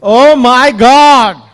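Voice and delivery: slow, loud drawn-out gasp of amazement; amazed exclamation. Real, not acted. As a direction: let the wonder stretch out. Host amazed by the innovations.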